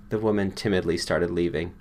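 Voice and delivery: ordinary delivery, nothing marked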